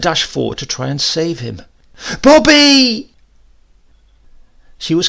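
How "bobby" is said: a piercing, high, full-voiced scream of the name; desperate scream. Mother screaming to her son in the road, desperate scream. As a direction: shriek it as the car bears down on him.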